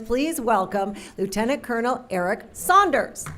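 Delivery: normal talking voice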